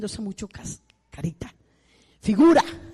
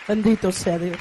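Bendito sea Dios proclaimed louder; louder blessing; 7 dB louder than usual. Acted. Preacher blessing God aloud before the congregation. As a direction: lift the blessing up to the whole church.

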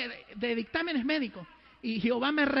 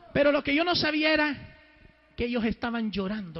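normal talking voice

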